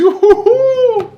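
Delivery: high, slow hooting laugh; laughing at his own nerve; gleeful laugh. Retold or imitated. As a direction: hoot with delight.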